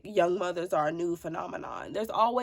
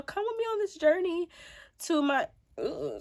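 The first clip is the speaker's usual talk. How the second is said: voice cracking; 8 semitones higher than usual. Real, not acted. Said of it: "did y'all hear my voice?".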